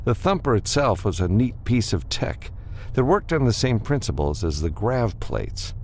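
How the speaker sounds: plain reading voice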